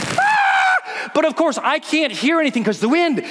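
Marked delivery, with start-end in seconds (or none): 0.0-1.1 very high shriek; falling scream. Retold or imitated. Skydiver screaming in freefall, very high. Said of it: "And I'm screaming".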